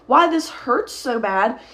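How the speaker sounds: normal talking voice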